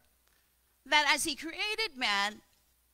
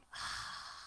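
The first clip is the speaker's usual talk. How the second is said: slow audible exhale; soft sighing out-breath, the name of God; long breath out; 11 dB quieter than usual. Shown as a demonstration. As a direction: breathe out slowly so it can be heard. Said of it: "You hear that sound?".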